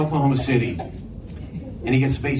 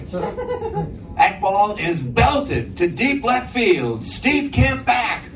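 home run call; 11 semitones higher, slower than usual. Retold or imitated. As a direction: build the call to an excited peak. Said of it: "this is my home run call".

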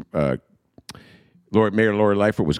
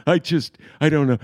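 ordinary talk

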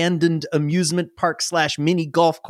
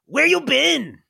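high eager goblin question; goblin brother; 11 semitones higher, slower than usual. Acted. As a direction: ask like a clingy goblin brother. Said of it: "he hops off and he goes".

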